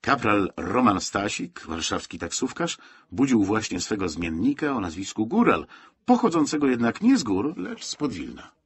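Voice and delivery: ordinary delivery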